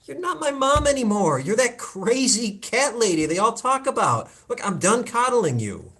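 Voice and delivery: loud furious confrontation; angry outburst. Acted. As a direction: let the anger burst out. Son confronts mother angrily.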